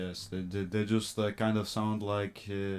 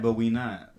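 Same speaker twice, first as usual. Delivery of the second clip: normal talking voice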